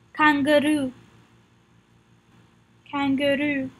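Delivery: kangaroo with French vowels, evenly stressed; French pronunciation. Shown as a demonstration. Teacher leads repetition, kangaroo in French pronunciation. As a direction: say kangaroo as a French word, level syllables. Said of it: "Practice the pronunciation with me".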